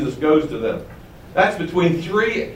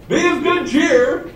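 high, drawn-out, chirpy delivery; comically untroubled greeting; cheery Jesus; 11 semitones higher, slower than usual. Acted. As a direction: say it absurdly cheerfully, pitched way up. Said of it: "You almost hear him say".

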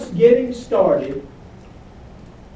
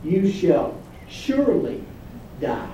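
surely stressed, quietly firm; You shall surely die, weighted on surely; emphatic; 3 semitones lower, 8 dB quieter than usual. Shown as a demonstration. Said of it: "It's emphatic".

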